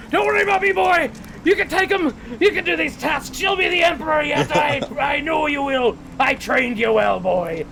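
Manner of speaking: high, loud shouting from a distance; shouted from afar. Acted. He yells.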